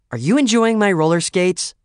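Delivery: high, loud, pointed question; indignant challenge. A narrator's reading. Lorraine to skaters using her pair, indignant challenge. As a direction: demand it as if catching someone out.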